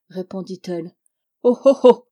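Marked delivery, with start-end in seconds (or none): 1.4-2.1 much louder, higher exclamation; a sharp, loud alarm call; dwarf's loud cry. Acted. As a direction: shout it to grab everyone's attention. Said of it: "s'écria en ce moment le nain".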